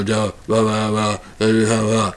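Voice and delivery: mock halting uh-uh filler; lightly voiced fumbling interview; athlete stammer. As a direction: imitate a tongue-tied athlete searching for words. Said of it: "When you hear them".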